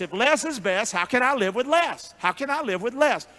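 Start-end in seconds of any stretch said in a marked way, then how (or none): none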